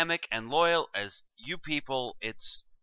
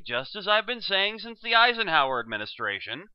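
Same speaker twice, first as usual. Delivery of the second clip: normal talking voice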